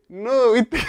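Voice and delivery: high-pitched mock Mendoza accent; imitating Mendoza speaker. Retelling someone's TV chat, mock Mendoza accent. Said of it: "Y dice".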